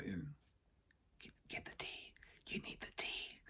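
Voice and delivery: insistent hushed whisper; little inner voice. Acted. Inner voice urging him to get tea, whispered. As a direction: whisper it like a nagging voice in the head. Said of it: "the little voice comes on".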